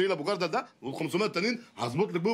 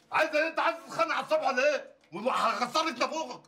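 angry shout; 8 semitones higher, faster than usual. Acted. Quarrel with wife.

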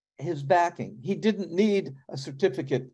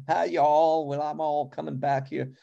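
put-on heavy Southern accent. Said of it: "talking with a heavy Southern accent".

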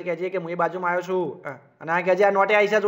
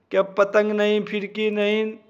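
normal talking voice

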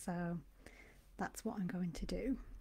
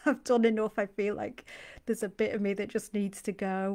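fed-up viewer voiced through a laugh, louder; 8 dB louder than usual. Voicing a viewer who has had enough, laughing. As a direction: laugh through the exasperated admission.

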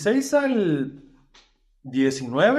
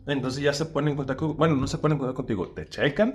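ordinary delivery

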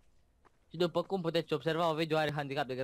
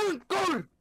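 high, drawn-out mock yell; playful shout on demand; shouting on request; 12 semitones higher, 3 dB louder than usual. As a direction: yell it out slowly, pitched up. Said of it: "ia strigă tu odată".

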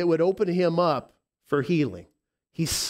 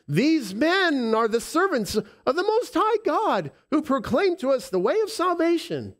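put-on shrill possessed girl voice; 11 semitones higher than usual. Possessed slave girl crying out.

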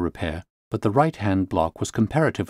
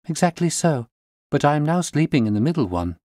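a touch higher, polite answer; lightly voiced young lady; 6 semitones higher than usual. A narrator's reading. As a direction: answer the detective plainly. Client answers Holmes.

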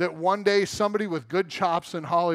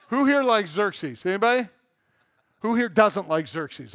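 laughing; 3 semitones higher, 4 dB louder than usual. Real, not acted. Asks congregation, laughing.

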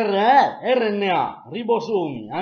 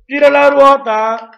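game show host; 9 semitones higher, 11 dB louder than usual. Pretend. Spinning the quiz wheel.

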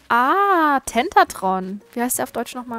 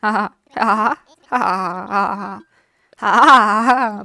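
loud forced ha-ha laughter; strange laugh; 7 dB louder, slower than usual. Pretend. With the pilot Bodo, a loud, weird staged laugh. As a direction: laugh oddly on purpose. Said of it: "Wieso lachen wir so komisch".

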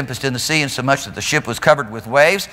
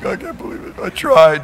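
put-on sulky whine; Peter; 5 semitones higher than usual. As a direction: sulk like a wounded disciple, mockingly. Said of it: "see Peter sulking".

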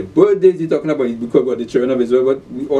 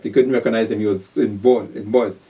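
usual speaking voice

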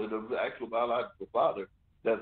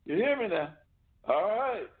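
put-on old man voice; 7 semitones higher than usual. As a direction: scold the kid like a stern grandfather. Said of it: "that old man voice".

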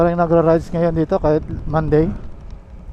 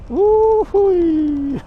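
excited whoop; 12 semitones higher than usual. Real, not acted. Riding downhill, cheering.

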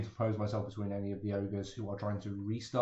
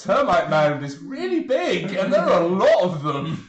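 laughing; 7 semitones higher, 12 dB louder, slower than usual. Acted. Player laughing voicing realization.

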